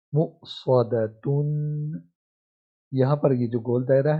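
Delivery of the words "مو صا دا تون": slow syllable blending. Shown as a demonstration. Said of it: "جب توڑ کرتے ہیں".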